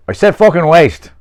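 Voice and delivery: loud, high, forceful repeat; irritated retort. Acted. Peter answering the older man's demand, loud irritated retort. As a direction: throw the words back at him.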